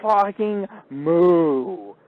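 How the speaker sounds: loud, high, long drawn-out mooing yell; yelled cow sounds. Retold or imitated. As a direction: holler it like a cow, stretching the moo way out. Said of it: "I yelled out".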